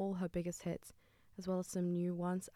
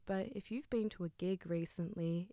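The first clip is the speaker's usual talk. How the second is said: ordinary delivery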